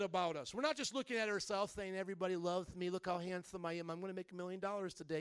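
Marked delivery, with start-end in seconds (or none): 1.9-5.2 a hammed-up preening boast, a little quieter; mock vain self-talk. Pastor mocking a congregant's mirror boasting, mock vain. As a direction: puff yourself up at the mirror, mockingly.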